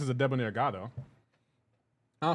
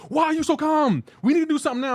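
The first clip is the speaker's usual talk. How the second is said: mock-panicked high, loud outburst; agitated girlfriend; 13 semitones higher, 7 dB louder than usual. Streamer mimicking girlfriend upset at his calm, high-pitched agitation. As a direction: imitate an exasperated partner, pitched way up and loud.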